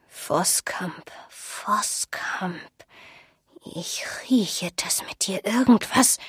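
put-on old seer murmuring to herself; eerie musing. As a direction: half-whisper it, as if sniffing out a memory. Old woman sniffs, ponders the name.

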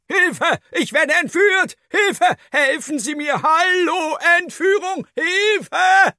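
a thumb-sized man screaming he's kidnapped; tiny dwarf crying for help. A narrator's reading. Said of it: "leise loszuschreien".

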